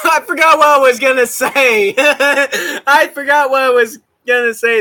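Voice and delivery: laughing through the words. Real, not acted. Streamer laughs, distracted by a chat message. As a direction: giggle it out, flustered. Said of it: "That distracted me".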